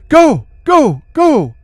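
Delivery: loud, high repeated yells; urgent shouting. A narrator's reading. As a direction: shout to push them out. Friend pushing to escape the cave.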